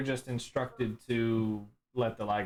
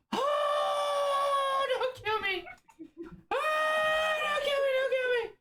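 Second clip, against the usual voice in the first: shrieking high-pitched begging, near tears; terrified pleading; 3 dB louder, slower than usual. Acted. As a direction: wail for mercy in a much higher voice. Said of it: "he goes".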